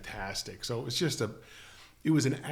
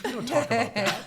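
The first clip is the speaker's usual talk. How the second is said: higher, louder put-on voice; mock-secretive Neil; 11 semitones higher, 6 dB louder than usual. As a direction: play him dodging the question, hammed up. Imitating Neil's dodge.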